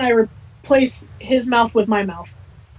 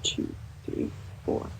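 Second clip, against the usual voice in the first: breathy whisper, counting dice softly; hushed dice count; 15 dB quieter, slower than usual. Real, not acted. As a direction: count them off under your breath. Player counting dice under breath.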